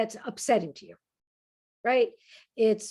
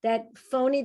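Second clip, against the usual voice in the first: usual speaking voice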